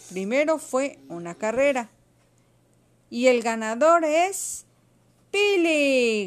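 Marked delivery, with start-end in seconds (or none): 3.1-6.3 slow, loud winner announcement; announcing shout. A narrator's reading. Esther announces the race winner, shouting. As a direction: draw it out, then cry the name high. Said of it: "gritó Esther".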